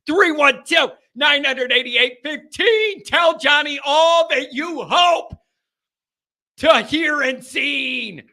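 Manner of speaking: loud, slow hyped phone-number read; announcer call-out. Acted. Host hyping the call-in line, announcer style. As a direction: belt it like a promo.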